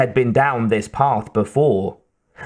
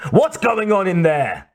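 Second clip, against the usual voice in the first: raised-pitch angry demand; 7 semitones higher than usual. A narrator's reading. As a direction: demand to know, pitched high. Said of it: "the Mercedes driver demanded".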